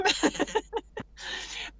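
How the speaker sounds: high-pitched light giggle. Real, not acted. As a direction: let out a high little laugh. Amused at not touching co-host.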